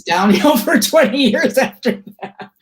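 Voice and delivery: joking, broken up by chuckles; laughing self-mockery. Real, not acted. Guest jokes about her career going downhill, laughing. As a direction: laugh through the words.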